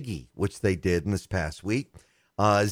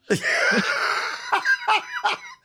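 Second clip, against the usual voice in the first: big burst of laughter. Real, not acted. Host reacts to cohost's too-much-information joke with a whooping laugh.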